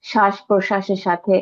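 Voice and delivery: ordinary talk, nothing marked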